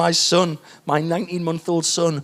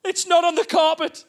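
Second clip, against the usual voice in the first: wife voice; 10 semitones higher, slower than usual. Retold or imitated. Wife's voice.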